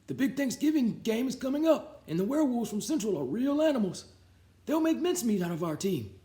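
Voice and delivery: put-on high, worried old janitor voice. A narrator's reading. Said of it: "said old, old Pop Hansen".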